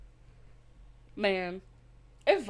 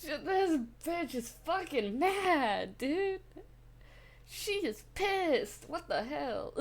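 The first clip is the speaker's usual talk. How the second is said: high, slow stunned exclamation; stunned, drawn-out gasp of a reaction; shocked disbelief; 7 semitones higher, much slower than usual. Real, not acted. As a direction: drag it out in amazed disbelief.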